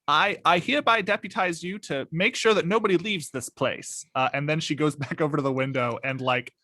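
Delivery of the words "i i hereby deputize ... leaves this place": higher-pitched, lightly acted official order; deputizing declaration. Ida deputizes Mister Cole at the window, raised character voice. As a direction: make a mock-formal pronouncement, pitched up. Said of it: "and says".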